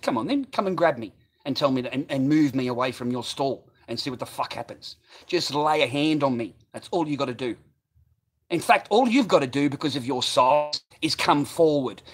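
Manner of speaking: reenacted confrontation, building; retold dare. Retold daring of stall holder.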